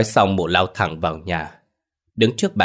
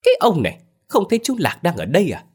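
high-pitched irritated wife; exasperated, high telling-off; snapping scold; 11 semitones higher, faster than usual. A narrator's reading. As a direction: scold him in a sharply raised pitch. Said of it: "Mụ gắt".

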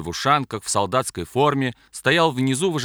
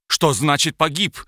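higher, louder shocked demand; outraged disbelief; 5 semitones higher, 4 dB louder than usual. A narrator's reading. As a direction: demand it, beside himself. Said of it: "вскочил вне себя".

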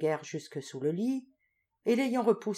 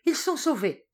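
much higher and louder, relieved joy; joyful cry; 9 semitones higher, 4 dB louder than usual. A narrator's reading. Marquis cries out to Raymond as his face lights up, joyful cry. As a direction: burst out with sudden relief. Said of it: "s'écria le marquis".

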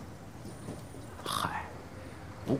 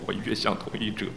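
ordinary delivery

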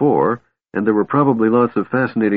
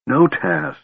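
higher, urgent protest; urgent pleading; 5 semitones higher than usual. A narrator's reading. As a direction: plead as if shaking him out of it. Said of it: "Tika said, shaking him".

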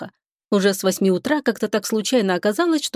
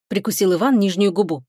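plain reading voice

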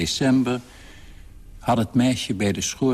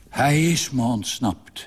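ordinary delivery

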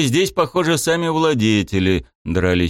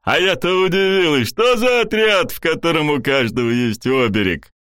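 booming baroness; 8 semitones higher than usual. A narrator's reading. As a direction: boom it out like a hearty noblewoman. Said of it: "громогласно произнесла баронесса".

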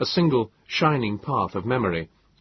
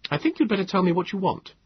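put-on stern young man; 11 semitones higher, faster than usual.

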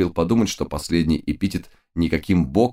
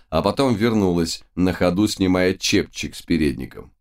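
ordinary delivery

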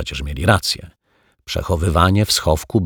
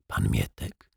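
usual speaking voice

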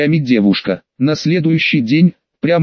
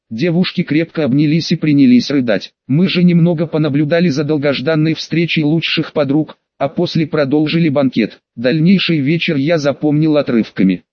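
plain reading voice

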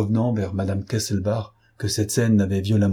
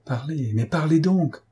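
higher-pitched, pressing command; urgent order; 6 semitones higher than usual. A narrator's reading. Police chief ordering the stunned witness, urgent command. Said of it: "ordonna monsieur Lenormand".

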